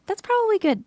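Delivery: ordinary delivery, nothing marked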